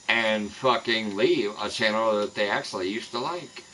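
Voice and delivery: normal talking voice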